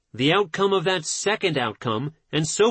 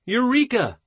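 understated cry of discovery, pitched higher; mild shout; 6 semitones higher than usual. A narrator's reading. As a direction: lightly exclaim it, raising the pitch without much volume.

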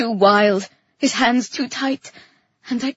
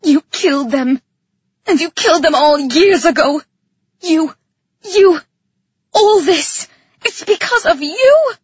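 loud, raised-pitch outburst building to a shout; hysterical accusation; 4 semitones higher, 8 dB louder than usual. A narrator's reading. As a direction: hurl the blame, losing control. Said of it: "I felt hysterical, dangerous, uncontrolled".

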